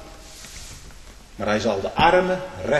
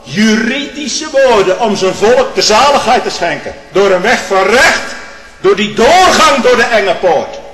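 fiery preacher climbing to a high, loud peak; preaching at full force; 7 semitones higher, 9 dB louder than usual. Acted. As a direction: build the sermon into a roar.

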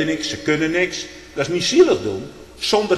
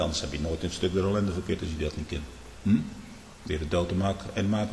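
much lower and softer, intimate murmur; hushed preaching; 8 semitones lower, 10 dB quieter than usual. Real, not acted. Telling the flock how God humbles them, in a low hushed murmur. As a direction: draw the listeners close, softly.

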